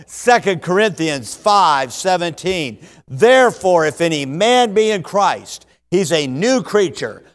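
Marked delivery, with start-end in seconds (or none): 3.1-7.2 loud, ringing group recitation, slow on the big lines; recited memory verse. Real, not acted. Congregation and preacher reciting the verse together standing. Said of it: "let's stand together and do it".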